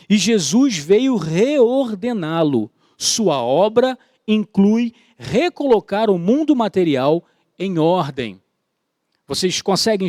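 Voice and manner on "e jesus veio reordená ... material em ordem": slow emphatic preaching. Real, not acted. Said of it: "slow emphatic preaching".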